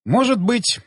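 quick, willing yes-nod in the voice; ready agreement. Acted. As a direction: agree at once, pitch lifted. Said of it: "закивал я сразу же с готовностью".

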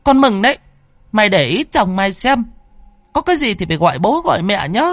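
high-pitched nagging wife; annoyed scolding. Acted. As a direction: scold the husband irritably in a high voice. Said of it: "nghe chồng nói như vậy thì bực mình". (bà vợ bực mình càu nhàu, giọng cao)

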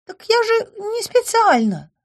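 put-on high, slow, bashful girlish voice; shy apologetic fairy; embarrassed nymph. A narrator's reading. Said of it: "Смущенно улыбнулась она".

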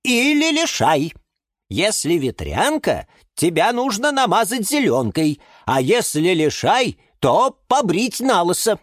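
high, emphatic character voice; bossy plant with medical advice. Acted. Voices a story figure.

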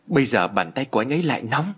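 ordinary delivery

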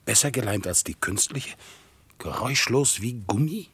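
low, soft, musing voice; hushed inner monologue. Acted. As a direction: think aloud quietly, pausing between phrases. (leiser, tiefer, nachsinnender innerer Monolog)

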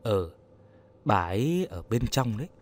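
low, subdued answer; dazed reply. A narrator's reading. Old man telling Yến his wife is inside, in a daze. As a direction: let it drop softly, absent-minded. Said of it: "thẫn thờ đáp".

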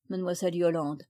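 plain reading voice

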